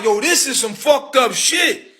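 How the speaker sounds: high slowed shout of outrage; outraged. Retold or imitated. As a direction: yell it out in disbelief. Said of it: "I'm like".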